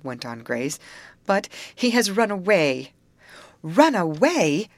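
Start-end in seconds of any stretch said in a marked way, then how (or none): 1.3-2.9 higher, louder announcement falling at end; grave reveal. A narrator's reading. Grace breaks the bad news. 3.6-4.7 slow, loud, high astonished question; surprised echo. A narrator's reading. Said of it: "It was a surprised chorus".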